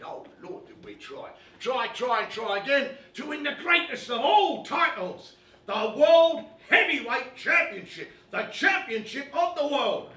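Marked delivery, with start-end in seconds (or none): 1.6-10.1 loud, high, rousing proclamation; all worked up. Acted. Boxing fan telling audience of the greatest title, all worked up. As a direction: build it like a fight-night rallying cry. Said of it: "Sorry, I get all worked up".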